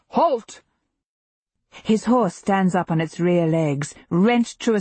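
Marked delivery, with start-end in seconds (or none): 0.1-0.6 higher-pitched, understated shout of command; mildly bellowed order. A narrator's reading. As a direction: call the riders to a stop, without full force. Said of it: "and he bellows".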